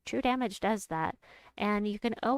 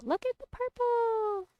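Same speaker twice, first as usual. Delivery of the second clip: very high sing-song coo; playful marvelling. Real, not acted. Player spotting lots of purple, playful high coo. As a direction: coo it high, stretching the last word. Cooing at purple gems on board.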